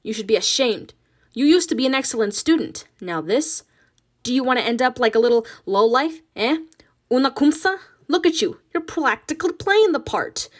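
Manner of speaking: put-on loud, angry Spanish-accented scolding; berating mother. Ma berating Nina as lazy, loud and angry. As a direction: shame her, building heat.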